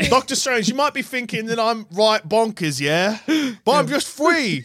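high-pitched, hammed-up cockney; mock British geezer, drawing out the last words; cockney Loki. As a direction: play a chirpy cockney trickster. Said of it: "It's me, Loki".

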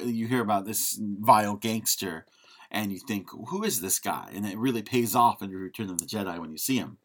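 normal talking voice

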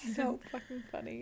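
voiceless whispered giggle line; hushed whispered aside. Real, not acted. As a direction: whisper it through amusement.

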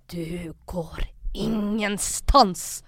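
slow, whispery menace, imitating an aggressor; mimicked sinister warning, very slow and voiceless; acted menacing threat. Retold threat blocking the way out.

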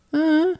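put-on high little "uh" cooed at a baby; short, high-pitched imitation coo; imitated dad's coo. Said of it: "he goes".

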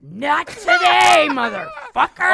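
loud, high, drawn-out yell breaking into laughter; mock furious shout. Worn-out woman to a catcaller on a bad day, exaggerated furious shout. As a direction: explode slowly at the offender, hammed up.

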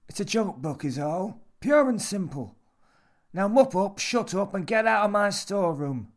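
high, loud, irritable orders; dismissive old man. A narrator's reading. Old man orders Jimmy out of his storeroom, irritable. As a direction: shut him down and throw him out.

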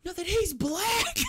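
very high, slow squeal breaking into laughter; high-pitched, laughing mock exclamation; mock outburst, laughing. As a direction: crack up while imitating the protest. Acted punchline, breaking into laughter.

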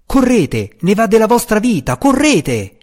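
high, fast, frantic yelling; urgent shout. A narrator's reading. Mateus orders the friars to flee, high frantic shout. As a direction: yell at them to run now.

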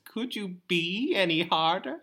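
high, laughing Chandler voice; Chandler impression. Retelling Anka's Friends-learned line, doing Chandler high and laughing. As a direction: pitch it way up as Chandler, through a laugh. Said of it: "That was supposed to be Chandler".

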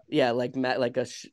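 ordinary talk

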